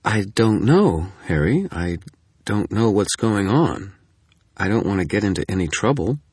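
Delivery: wavering, unwilling to get involved; hesitant. A narrator's reading. Susan hesitates to help.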